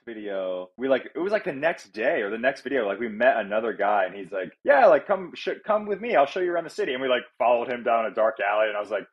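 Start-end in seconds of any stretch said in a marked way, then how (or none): 4.6-6.9 high, eager mock invitation; imitated inviting stranger. Stranger offering to show them round the city, imitated eagerness. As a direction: pitch it up like an over-keen guide.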